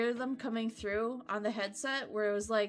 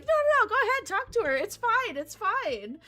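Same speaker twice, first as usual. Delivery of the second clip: eager reassurance; 8 semitones higher, 5 dB louder than usual. Retold or imitated. Staff urging him to talk.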